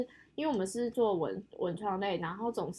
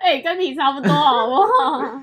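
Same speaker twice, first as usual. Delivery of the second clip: teasing through laughter, louder; laughing jab at a friend; laughing tease; 4 semitones higher, 14 dB louder than usual. Real, not acted.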